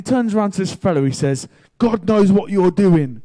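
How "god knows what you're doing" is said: loud, raised, slow accusation; bold confrontation. Retold or imitated. Friend confronting a stranger in the street, bold accusation. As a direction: call him out, slow and forceful.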